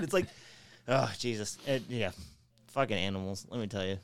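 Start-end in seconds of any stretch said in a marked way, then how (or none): none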